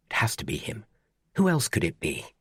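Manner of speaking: unvoiced anxious whisper; muttered doubt to oneself; whispered thought. A narrator's reading. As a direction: breathe it to yourself. Inner panicked thought.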